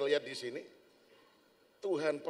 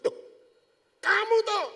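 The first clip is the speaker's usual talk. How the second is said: high, loud startled recognition; shocked to find him here; surprised; 13 dB louder than usual. Acted. Old classmate surprised to see his friend.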